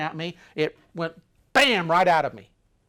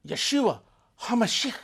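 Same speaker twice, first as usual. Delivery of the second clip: said loud; 8 semitones higher, 6 dB louder than usual. Retold or imitated. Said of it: "just said it loud".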